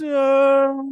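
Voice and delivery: high, loud melodramatic wail; hammed-up howl of grief; Dracula's anguished cry. Acting out Dracula's grief over bride.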